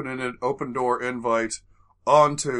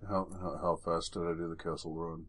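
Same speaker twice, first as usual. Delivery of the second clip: dazed Harrison Ford; 8 semitones lower, 13 dB quieter than usual. Retold or imitated. Dazed Ford after plane crash.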